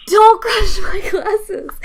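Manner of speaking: high, loud mock pleading whine; imitating a panicked man begging; pleading nervous guy. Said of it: "And he was like".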